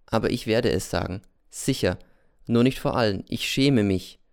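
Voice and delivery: ordinary delivery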